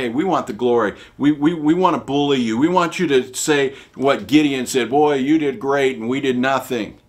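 loud mock bully demand; bullying Ephraimites. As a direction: push for the glory, loudly.

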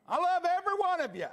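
joyful shout; exuberant shout. Real, not acted. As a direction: burst out with joy at the church. Exuberant sign-off to congregation.

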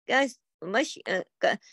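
normal talking voice

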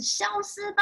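high-pitched battle cry; banishing cry. Acted. Soap hero blasts the monster away, high cry. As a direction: call it out high to banish the villain.

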